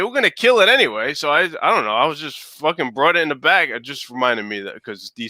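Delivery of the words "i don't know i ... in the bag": ordinary talk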